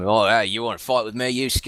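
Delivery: hammed-up Aussie drunk spoiling for a fight; Australian accent. Podcaster imitating the dub's Australian accent for Chu. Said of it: "giving him an Australian accent".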